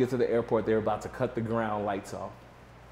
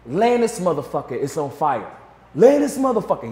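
louder, higher panic building to a yell; frantic shout getting higher; panicked yelling; 5 semitones higher, 6 dB louder than usual. Retold or imitated. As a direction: imitate the lawyer freaking out. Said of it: "He's, like, tripping out".

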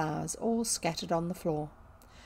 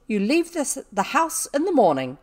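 loud, higher-pitched scolding; angry dismissal; 5 semitones higher, 8 dB louder than usual. A narrator's reading. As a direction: order the servant out in outrage. Furious dismissal of servant.

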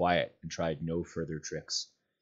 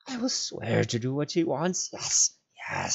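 pleading creature; 5 semitones higher than usual. A narrator's reading. Gollum pleads.

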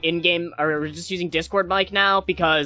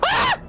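sudden very high shriek; startled scream. Real, not acted. Player suddenly caught by a monster, startled scream. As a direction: yelp in shock, pitch leaping.

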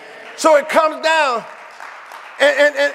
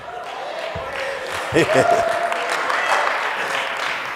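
a long, low breathy laugh; 5 dB quieter than usual. Real, not acted. Preacher to congregation, long low laugh. Laughs after getting happy.

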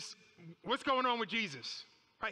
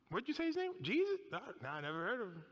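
feigned ignorance; faster than usual. Acted. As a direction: play Peter pretending not to recognise the name. Acts Peter feigning ignorance of Jesus.

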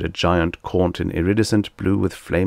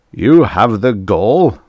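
loud, slow, raised outrage; storming; 4 semitones higher, 9 dB louder, slower than usual. A narrator's reading. Korn outraged at his chained captive, storming. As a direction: storm at him, stretching each word. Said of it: "Korn stormed".

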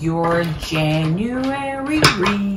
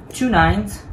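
ordinary talk

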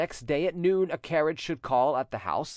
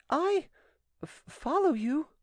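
very high, halting question; stammered astonishment. Acted. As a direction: stumble on the word as if taken aback. Angelique startled by the proposal.

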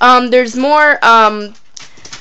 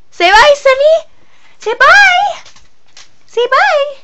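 high and louder, coaxing the dog; 4 dB louder, slower than usual. Real, not acted. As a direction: coax the dog in a squeaky voice.

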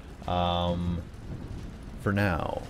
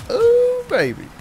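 high whooping cheer, much louder; gleeful yell; 5 dB louder, much slower than usual. Real, not acted. Gamer whooping as his car charges in. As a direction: whoop like a rodeo rider. Ramming enemies in game.

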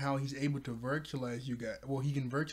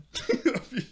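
high-pitched burst of laughter; 11 semitones higher, 6 dB louder than usual. Real, not acted. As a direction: cackle at the screen. Laughing at the face.

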